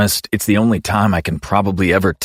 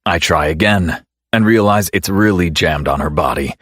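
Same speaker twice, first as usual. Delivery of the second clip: plain reading voice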